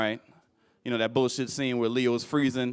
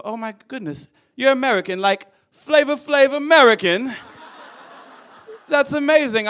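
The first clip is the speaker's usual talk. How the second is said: gushing foreigner; 9 semitones higher, 5 dB louder than usual. Retold or imitated. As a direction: gush like an impressed tourist. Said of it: "and they go".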